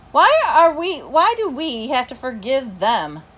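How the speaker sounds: loud, high-pitched demand to know why; exasperated protest. A narrator's reading. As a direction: burst out questioning, high and loud at first. Narrator dog challenges forgiving cruel owners.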